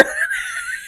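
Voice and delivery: high-pitched, soft laugh; a short, real laugh, pitched very high. Real, not acted. Laughing at their own painted-nails story, high laugh.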